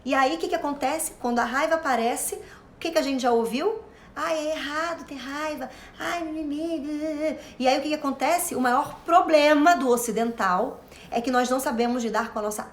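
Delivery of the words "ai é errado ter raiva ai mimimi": soft, high, slow whiny mimimi; mocking whine. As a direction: sneer at the complainers. Mocking what people say. (deboche choroso e arrastado)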